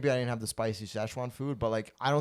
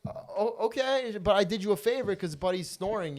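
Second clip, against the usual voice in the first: mock innocent higher; 10 semitones higher, 4 dB louder than usual. His own retold reply.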